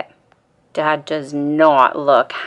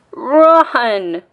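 higher, louder warning cry; yell; 6 semitones higher, 4 dB louder than usual. Acted. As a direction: shout it like a sudden alarm. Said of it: "Dad yells".

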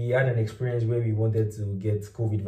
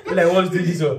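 laughing, louder; 6 semitones higher, 5 dB louder than usual. Real, not acted. Laughing about Nigerians speaking French to each other. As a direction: laugh through the question. Laughing at language switch story.

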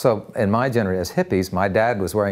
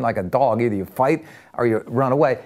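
ordinary delivery

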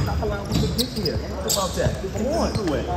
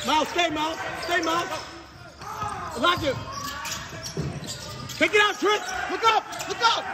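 calling out; 7 semitones higher, 4 dB quieter than usual. Real, not acted. Coach calling to player on court.

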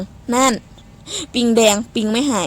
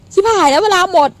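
high, loud alarmed yelp; panicked outburst; 7 semitones higher, 7 dB louder than usual. Real, not acted. Streamer realising time is up, yelping in panic. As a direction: blurt it in panic. Timer runs out in game.

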